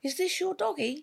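put-on high, sing-song voice for little kids; talking to children. Officer gently asking lost girls about the dog, in a high sing-song. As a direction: coo sweetly to a small child. Cooing to two found girls.

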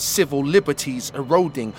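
ordinary talk, nothing marked

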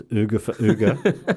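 "Uga for Uga" slowed down with a laugh, put-on Swedish; mock Swedish. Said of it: "outside of the phrase".

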